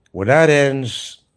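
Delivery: ordinary talk, nothing marked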